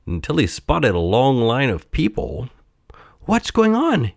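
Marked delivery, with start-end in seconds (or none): 3.2-4.1 put-on high, childlike puppet question; high piping curiosity; curious Pinocchio. A narrator's reading. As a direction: ask like a curious little boy. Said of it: "he asked".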